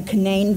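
Canaan as kuh-NANE, second syllable stressed; West Virginia pronunciation. Shown as a demonstration. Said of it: "it's pronounced Canaan".

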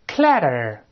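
clatter read clearly as the English word; model reading. Shown as a demonstration. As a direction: say clatter for the class to repeat. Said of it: "我们大声再读一遍".

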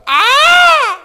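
very high, loud held cry; imitated piercing whoop; grandfather's yell. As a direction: holler like grandpa in the cantina. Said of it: "mi abuelo gritaba y decía".

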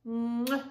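kiss goodbye into the microphone; blown kiss. Real, not acted. Grandma blowing a kiss to her grandbabies.

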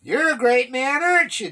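loud, high-pitched taunt. A narrator's reading. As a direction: jeer at him across a distance. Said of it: "David taunted".

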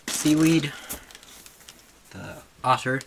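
ordinary talk, nothing marked

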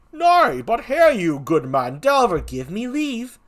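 projected comic clown voice; second clown. Acted. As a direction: cut in as an insistent gravedigger.

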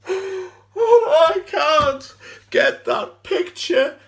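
high, loud sobbing breakdown; anguished crying. Acted. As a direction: wail it through tears, haunted by the sight. Breaks down recalling her body.

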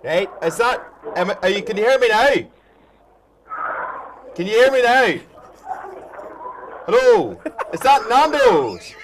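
loud, repeated hellos into the phone; pundit yelling into a poor phone connection; calling down the line. Real, not acted. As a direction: shout to get heard on a bad line. Shouting down a bad phone line.

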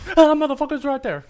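high, loud frightened yell; startled yell. Real, not acted. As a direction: scream as it appears.

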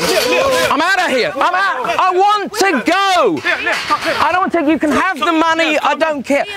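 high, strained, slow distressed outburst; upset shouting. Real, not acted. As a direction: break down in a high, desperate rant. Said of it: "He's shouting and swearing".

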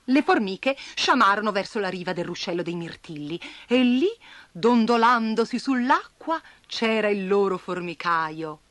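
ordinary delivery, nothing marked

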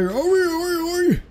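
high squeaky oi noises; imitating monster noises. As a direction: squeak out the creature's calls. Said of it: "It's like".

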